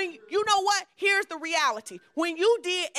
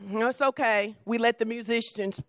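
usual speaking voice

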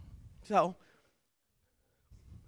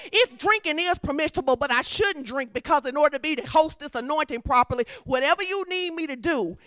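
loud, high, driving sermon delivery; loud, insistent preacher's declaration; full-force preaching; 5 semitones higher, 8 dB louder than usual. Real, not acted. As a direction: preach it hard, line building on line.

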